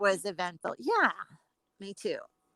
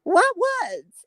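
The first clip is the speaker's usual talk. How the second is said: imitating the nail tech; 10 semitones higher, 3 dB louder than usual. Retelling the nail tech's reply.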